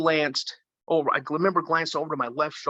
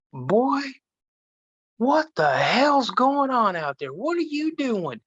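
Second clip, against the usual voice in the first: put-on higher, slow, alarmed old woman demanding answers; grandma scolding; 9 semitones higher, slower than usual. Great grandma startled as the boy bursts through the door, scolding.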